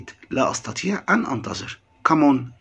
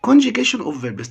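announcing heading; 9 semitones higher, 5 dB louder, slower than usual. Shown as a demonstration. Announces new section.

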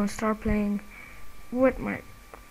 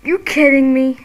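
angry outburst; 3 semitones higher, 11 dB louder than usual. Real, not acted. Gamer dying yet again, a loud outburst. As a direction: blast it at the screen.

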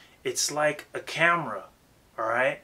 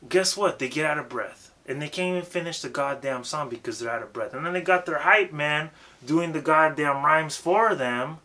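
usual speaking voice

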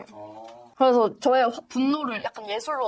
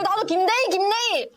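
teasing; 10 semitones higher than usual. Pretend. Teasing, pointing at elder.

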